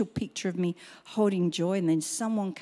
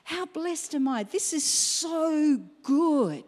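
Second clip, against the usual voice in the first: quiet awe; 6 semitones higher, slower than usual. Retold or imitated. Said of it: "and I thought".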